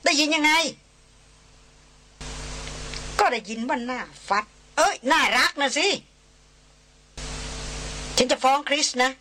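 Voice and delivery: gleeful playful threat; excited teasing. A narrator's reading. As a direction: crow like you caught him out.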